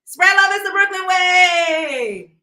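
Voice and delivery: high, loud, drawn-out sign-off yell; big hyped-up farewell shout; shouted catchphrase. Real, not acted. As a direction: holler it out, stretching the last word slowly.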